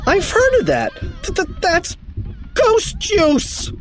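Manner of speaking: high, stammering panic; alarmed cry. A narrator's reading. Bug recognizing ectoplasm, high stuttering cry. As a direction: build to a horrified yell. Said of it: "cried Bug".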